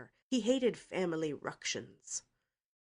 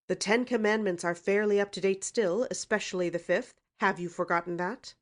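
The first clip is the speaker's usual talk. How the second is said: heavy; 4 semitones higher, 8 dB louder than usual. A narrator's reading.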